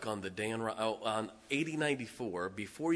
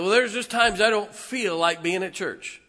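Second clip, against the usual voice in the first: higher, louder whiny excuse; mock churchgoer excuse; 9 semitones higher, 8 dB louder than usual. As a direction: voice the congregation's excuse.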